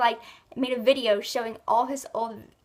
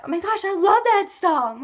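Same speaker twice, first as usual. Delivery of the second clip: excited gushing; 7 semitones higher, 7 dB louder than usual. Real, not acted. Vlogger telling viewers about a song she loves, excited gushing. Gushes over a favorite song.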